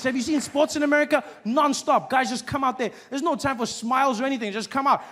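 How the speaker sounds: ordinary delivery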